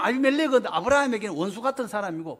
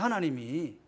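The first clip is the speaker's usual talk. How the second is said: "하나님이" repeated softly and lower, as a quiet echo; 7 semitones lower, 7 dB quieter than usual. Shown as a demonstration. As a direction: let "하나님이" fall away quietly. ("하나님이"를 낮고 조용하게 되뇌듯)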